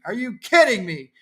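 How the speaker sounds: ordinary talk, nothing marked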